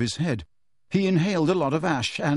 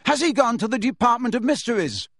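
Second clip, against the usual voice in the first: higher, urgent call; 10 semitones higher than usual. Acted. Harry shouting after Kreacher, urgent. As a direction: call him back.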